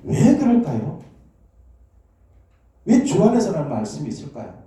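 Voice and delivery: usual speaking voice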